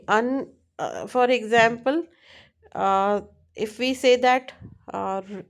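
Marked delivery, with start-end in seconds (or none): none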